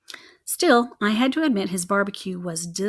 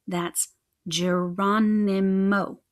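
That's Geronimo said slowly, each syllable of Geronimo drawn out; slow, spelled-out correction; much slower than usual. A narrator's reading. Geronimo corrects Punkrat, saying Geronimo slowly and deliberately. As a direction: insist on Geronimo with patient slowness. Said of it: "I repeated".

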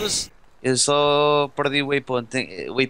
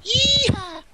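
excited whoop. Real, not acted. Whoop after finding the jet.

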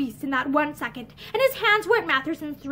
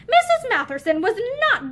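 agitated; 8 semitones higher, 3 dB louder than usual. A narrator's reading. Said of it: "he went on".

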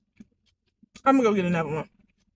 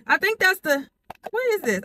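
ordinary talk

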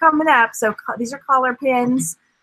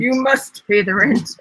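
twirl the mustache and sneer; mustache twirling villain. Acted. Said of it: "style your mustache".

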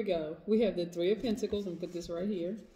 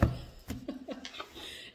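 airy burst of laughter; 8 semitones higher, 7 dB quieter than usual. Real, not acted. Laughs at her own remark.

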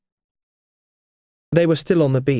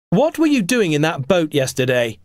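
raised, higher-pitched angry demand; mild shout; 6 semitones higher, 3 dB louder than usual. A narrator's reading. Tom confronting the man about the boat, mild shout. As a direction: shout it lightly, as in story narration. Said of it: "Tom shouted".

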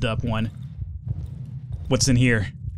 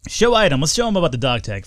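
ordinary talk, nothing marked

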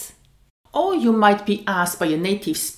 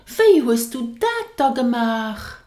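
amazed "Wie hast du dat da gemacht?", a touch louder; surprised question; 5 semitones higher, 5 dB louder than usual. Shown as a demonstration. Teacher asking a friend in amazement. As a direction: ask in wonder at a friend's feat. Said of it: "So you show surprise".

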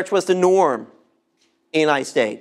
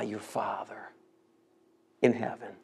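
whisper; 13 semitones lower, 15 dB quieter than usual. Acted. Preacher ends scripture quote hushed.

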